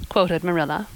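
plain reading voice

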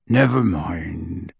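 low, slow, gruff giant's voice; a rough, low comfort from Hagrid; lightly gruffed big man. A narrator's reading. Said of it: "he said gruffly".

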